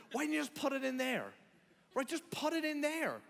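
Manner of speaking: high-pitched pleading exasperation; exasperated master. Acted. Exasperated master questions servant.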